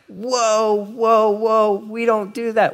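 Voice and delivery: high startled protest, whoa whoa whoa; shocked whoa. Retold or imitated. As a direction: recoil in mock alarm. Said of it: "and you're like".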